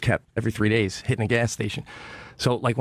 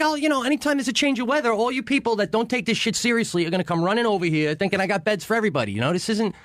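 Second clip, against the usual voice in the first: higher-pitched imitation of a stern scolding; hardcore chalet keeper; 8 semitones higher than usual. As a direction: lecture them as the hardcore chalet boss. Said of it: "it's really hardcore".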